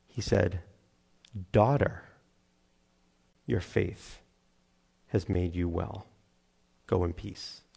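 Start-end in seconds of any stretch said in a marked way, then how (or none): 1.5-7.6 low, very soft, slow with long pauses; hushed, tender blessing. Acted. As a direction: say it gently, as to a beloved daughter. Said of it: "Is there a more tender word".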